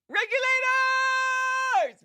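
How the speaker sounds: high, long drawn-out shout; rallying call. Pretend. Streamer calling up the regulators, rallying shout. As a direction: holler it slowly like a rallying cry. Calls out to the audience.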